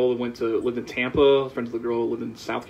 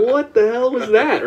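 high, loud, stretched-out incredulity; acted-out flabbergasted reaction; baffled disbelief; 11 semitones higher, 5 dB louder than usual. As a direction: draw it out, pitched way up. Retelling shocked reaction to cashier.

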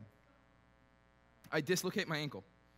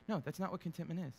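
ordinary talk, nothing marked

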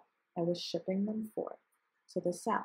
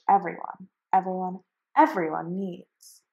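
louder, insistent everyone, everyone; defiant shout; 8 dB louder than usual. A narrator's reading. Onceler defying the Lorax, louder insistent shout. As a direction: hammer the everyone home.

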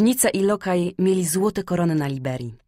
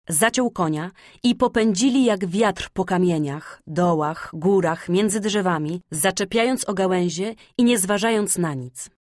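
plain reading voice

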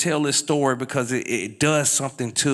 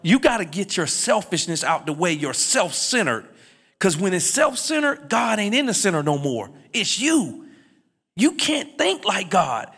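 high-pitched, building preacher's call to the crowd; full preaching; 11 semitones higher than usual. Acted. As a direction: preach it out to the congregation, rising.

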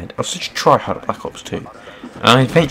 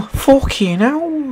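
much higher, very slow, drawn-out unplaceable mock accent on "Fucking hell"; mystery accent; 3 dB louder, much slower than usual. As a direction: stretch "Fucking hell" out in a silly, unidentifiable foreign accent. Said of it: "I don't know what accent that was".

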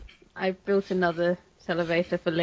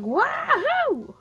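high whooping Texan holler; cowboy yell. Retold or imitated. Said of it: "She's like".

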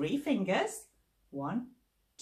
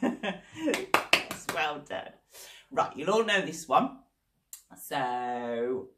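ordinary talk, nothing marked

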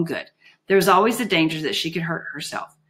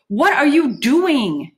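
high, loud, drawn-out demand; panted outburst; 8 semitones higher, 6 dB louder, much slower than usual. A narrator's reading. Juliet bursting into the cell at the noise, panted and alarmed. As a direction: stretch out the panted question, pitched way up. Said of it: "she panted".

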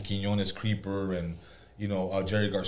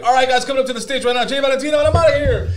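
fast, much higher, louder hurried announcer; rushed emcee handoff; 13 semitones higher, 9 dB louder, faster than usual. Retold or imitated. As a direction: gabble the intro and bolt. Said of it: "And I was like".

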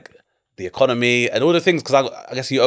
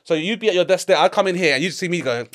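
usual speaking voice

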